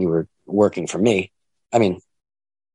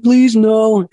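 high, loud mock plea for mercy; mock begging; 12 semitones higher, 6 dB louder than usual. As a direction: imitate a scared guy begging, higher and louder. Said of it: "He's like".

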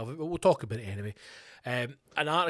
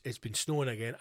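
ordinary delivery

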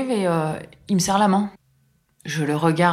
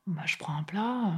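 softer, whispered giving in; resigned whisper; 13 dB quieter than usual. Retold or imitated. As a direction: whisper it like you've lost the argument. Elle cède, résignée. (chuchoté, résigné)

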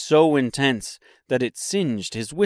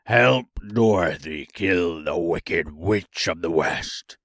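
deep, gruff monster order; beast's voice; 5 semitones lower than usual. A narrator's reading. Beast giving the woodman his task, deep gruff voice. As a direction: give the order from low down. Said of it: "replied the beast".

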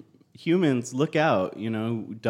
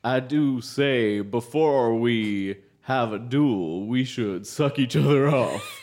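slow, louder Western drawl; cowboy voice; 4 dB louder, slower than usual. Pretend. Cowboy bong proposing before duel.

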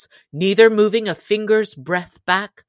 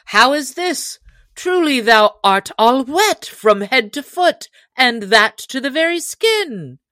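high-pitched voice of an astonished follower; astonished cry; 7 semitones higher, 3 dB louder than usual. A narrator's reading. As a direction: exclaim high, all the way through. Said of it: "cried Will".

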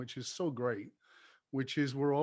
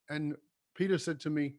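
ordinary talk

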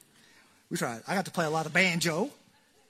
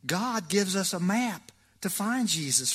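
normal talking voice

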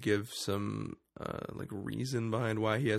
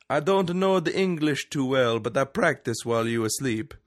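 louder, raised cartoon Italian accent; mock Italian accent; 4 semitones higher, 6 dB louder than usual. Podcaster retells the villain addressing his waking captive, mock Italian accent. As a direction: imitate a villain in halting, Italian-accented English. Red Skull's Italian voice resumed.

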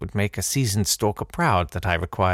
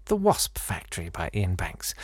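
softer, hushed title; whisper; 5 semitones lower, 6 dB quieter than usual. A narrator's reading. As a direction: breathe the title conspiratorially.

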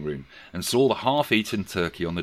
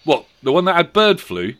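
put-on boy voice; 9 semitones higher, 8 dB louder than usual. A narrator's reading. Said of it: "returned the boy".

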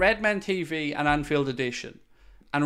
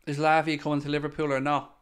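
ordinary talk

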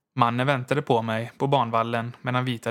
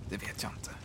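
hushed, voiceless whisper; 3 semitones lower, 12 dB quieter than usual. A narrator's reading. Signalman whispering his fear to the visitor. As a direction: breathe it out so no one else hears. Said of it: "talade så lågt att det knappast var mer än en viskning".

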